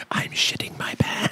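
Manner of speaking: unvoiced whisper into an ear. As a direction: whisper it close and secret. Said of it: "gonna say something like".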